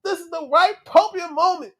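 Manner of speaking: high, loud, desperate yell; frantic pleading. Retold or imitated. Fan begging [name] to save his son, frantic shout. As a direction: beg him at the top of your voice. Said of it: "I was like".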